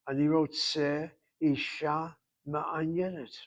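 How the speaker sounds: slow careful "Ani rotze Isha Ma'anyenet"; model Hebrew phrase. Shown as a demonstration. Teacher models a Hebrew phrase for the class, slow and careful. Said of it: "make sure you know how to say".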